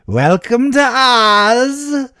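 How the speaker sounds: drawn-out high sing-song welcome to Oz; hammed-up slow loud high welcome; Oz introduction. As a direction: stretch it out like a ring announcer. Imitating Sullivan's Oz line.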